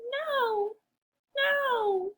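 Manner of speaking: very high squeaky no; shrill it like a tiny pig. Acted. Pigs refuse.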